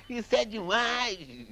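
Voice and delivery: high, slow exclamation of delight, drawn out; man at the samba contest cries out again, high and slow; excited cry. Retold or imitated. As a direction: stretch it out, high and amazed.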